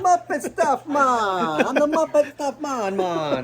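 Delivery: high, silly chanted "man, man"; goofy Muppet voice. Pretend. Joking to the camera, high giddy Muppet-style chant.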